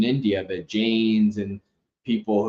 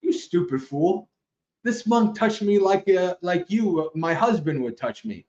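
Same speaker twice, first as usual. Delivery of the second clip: a touch higher, scolding her husband; mild mock scolding of a clueless husband; wife snapping at her husband, lightly voiced; exasperated wife; 7 semitones higher than usual. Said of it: "the wife was like".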